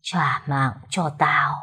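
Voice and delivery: low, slow ghostly moan; eerie ghost voice. A narrator's reading. Ghost demands the servant's life, low and slow. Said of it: "giọng âm u".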